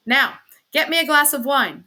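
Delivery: cross, insistent command; peevish demand. Acted. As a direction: demand it as your due. Husband's peevish demand in acted quarrel.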